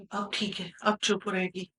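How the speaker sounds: very soft, low aside to oneself; quiet aside. Acted. Nurse satisfied the sedated patient will quieten, murmuring low. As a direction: drop right down, talking to yourself.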